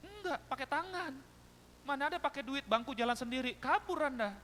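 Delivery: high, loud incredulous comeback; mock retort. Voices a sarcastic retort in anecdote. (membalas sewot dengan suara tinggi dan keras)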